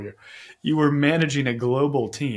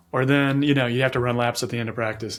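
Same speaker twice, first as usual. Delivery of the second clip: ordinary talk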